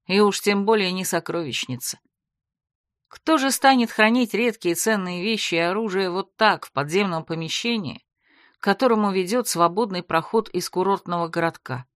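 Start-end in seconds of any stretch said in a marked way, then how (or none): none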